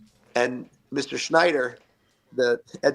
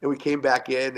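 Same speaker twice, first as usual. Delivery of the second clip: ordinary talk, nothing marked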